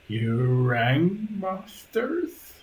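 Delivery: slow, rising creature greeting; creepy butler. Acted. Stitched-together servant greets the masters, creepy butler. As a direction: pitch climbing into a questioning greeting.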